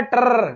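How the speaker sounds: "ट्रर" as one long rolled R; buzzing, drawn-out "ट्रर"; trilled sound. Retold or imitated. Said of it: "ये जीत यादव बोल रहा है ट्रर".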